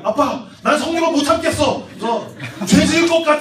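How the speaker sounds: loud, high comic outburst; confessing to dad. Acted. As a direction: blurt it out to your father. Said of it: "그럼 아빠한테 얘기해".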